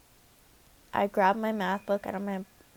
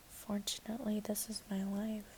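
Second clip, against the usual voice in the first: soft unvoiced whisper; 11 dB quieter than usual. Real, not acted. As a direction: breathe it out quietly. Whispered aside.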